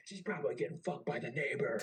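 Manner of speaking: high-pitched imitation of a taunting chief; mock berating jab; jeering taunt. Chief taunting him during berating.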